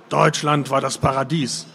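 usual speaking voice

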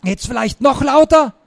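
high, pressed sarcastic question; feigned alarm, scolding; mock-shocked hiss. A narrator's reading. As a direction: hiss it as if horrified. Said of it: "Ich tat furchtbar erschrocken und zischte".

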